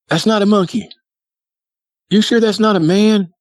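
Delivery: put-on high, doubting kid voice; little brother. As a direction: imitate a small boy questioning his dad. Voicing little brother questioning Dad.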